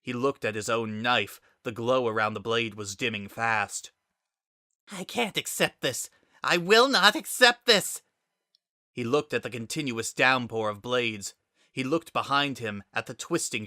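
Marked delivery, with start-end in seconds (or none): 4.9-8.0 high, building from level to loud; defiant refusal. A narrator's reading. Kara refuses to accept Frisk's approach, building to a high defiant cry. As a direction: dig in and refuse, rising to a cry.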